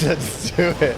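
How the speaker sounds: laughing through the words; amused laugh as you say it. Real, not acted. Laughing at the AI's answer.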